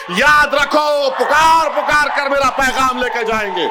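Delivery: fiery orator's shout; rousing shout. Real, not acted. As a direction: call it out to the whole crowd. Rousing close of a speech.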